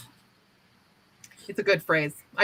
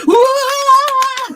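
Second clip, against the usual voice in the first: very high, loud mock gasp of amazement; mock amazed whoa; 11 dB louder than usual. Japanese listeners amazed by the humble phrase, loud high whoa. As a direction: act blown away.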